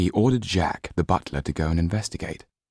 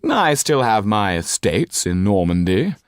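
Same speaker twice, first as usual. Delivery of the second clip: a touch louder, higher and defiant; said loudly; 4 semitones higher, 5 dB louder than usual. A narrator's reading. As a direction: insist on it, raising the voice a little. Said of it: "the Marquis said loudly".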